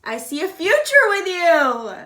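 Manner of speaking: loud, slow, high declaration; excited. Acted. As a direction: announce it with delight. Channeled message read out.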